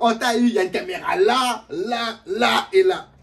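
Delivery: loud gotcha reveal; prank host gotcha. Pretend. Imagined prank show host, loud and triumphant. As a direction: announce the gotcha loudly.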